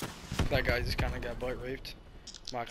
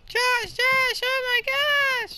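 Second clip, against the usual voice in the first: very high, loud, drawn-out falsetto squeal; hammed-up girlish scream, stretched slow; mock girl screaming louder; 9 dB louder, much slower than usual. Gamer acts a girl saying it louder, mock falsetto scream. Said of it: "Say it louder".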